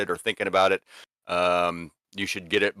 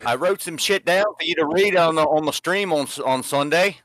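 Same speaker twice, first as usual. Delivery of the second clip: higher, a lightly held Aussie voice; horrible Australian accent; 9 semitones higher, 4 dB louder than usual. Retold or imitated. Adam, on stream, tells the host in a weak Aussie accent. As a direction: keep up a shaky Aussie impression. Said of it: "that's my horrible".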